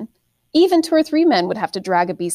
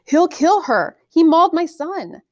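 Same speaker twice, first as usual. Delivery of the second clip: afraid; 9 semitones higher, 5 dB louder than usual. A narrator's reading. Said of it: "the farmer said".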